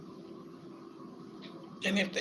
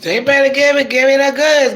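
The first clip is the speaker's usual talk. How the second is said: loud, high, thrilled demand; real excited yelling; excited shout; 5 semitones higher, 10 dB louder than usual. Real, not acted. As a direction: call out for the goods, overjoyed. Excited about family records.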